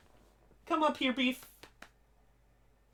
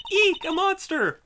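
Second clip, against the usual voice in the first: "Eek, a monster!" much higher and louder, a hammed-up shriek; mock shriek; 6 dB louder than usual. Shown as a demonstration. Redoing the game girl's line as a hammed-up shriek. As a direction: squeal "Eek" like a cartoon scare.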